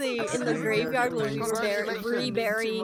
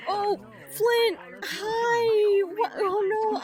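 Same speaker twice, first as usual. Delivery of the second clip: excited squeal; 8 semitones higher, much slower than usual. Real, not acted. Surprised by an incoming raid.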